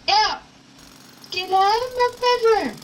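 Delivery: high, urgent demand to leave; alarmed order. Acted. Startled girl to intruder in her bedroom, alarmed order.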